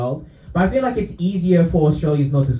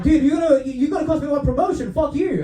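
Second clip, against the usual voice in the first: mock indignation; 10 semitones higher than usual. Commuter cursing the man he saved from the train, mock indignant. Rescued man retold as cross.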